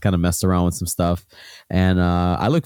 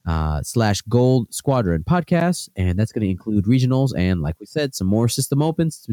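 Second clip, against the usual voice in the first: upbeat podcast plug, slightly raised pitch; promo read; 4 semitones higher than usual. Acted. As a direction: read the channel ads like an announcer. Reads the show's sign-off promos.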